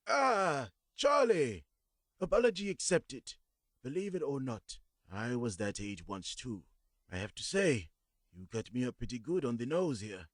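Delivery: put-on high, bright old-man voice, forgiving; cheerful old man. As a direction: greet the boy like a kindly grandfather. Old man answers kindly.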